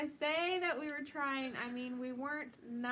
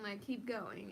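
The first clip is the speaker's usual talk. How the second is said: ordinary delivery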